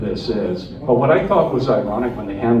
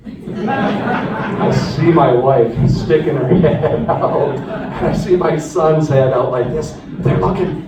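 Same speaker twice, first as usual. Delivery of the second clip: louder and higher, laughing happily; laughing with joy; 5 semitones higher, 7 dB louder, slower than usual. Real, not acted. Recalling seeing his wife and son, laughing joyfully. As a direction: relive the moment, laughing.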